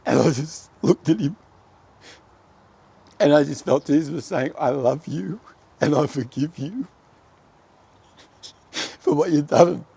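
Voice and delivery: high, loud, tearful voice with long halts; choked up. Real, not acted. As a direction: fight back real sobs to get it out. Overcome recounting Jesus forgiving him.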